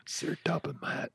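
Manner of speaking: whisper. Pretend. Dark reboot whisper.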